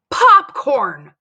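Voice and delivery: high, loud cry of disbelief; outraged exclamation. Acted. Dory tells Mary about the popcorn, outraged. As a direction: exclaim it, scandalized.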